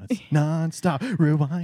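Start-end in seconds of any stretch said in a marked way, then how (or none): 1.2-1.6 high rising rewind call; playful rewind noise; rewind sound effect. Pretend. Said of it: "is that a good rewind sound".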